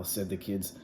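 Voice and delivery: usual speaking voice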